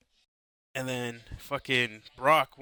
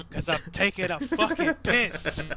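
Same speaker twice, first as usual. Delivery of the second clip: slow, much higher, laughing through the punchline; 7 semitones higher, slower than usual. Retold or imitated. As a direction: crack up while delivering the quote. Retelling peeing while friend asks.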